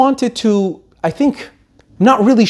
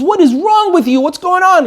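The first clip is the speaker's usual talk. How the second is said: alarmed; 11 semitones higher than usual. Retold or imitated. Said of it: "she runs, she goes".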